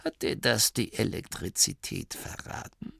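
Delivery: put-on soft, sneering old voice; mock him gently. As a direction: abbot ridiculing the inventor, quiet. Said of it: "Spott erklang in der Stimme des Abts".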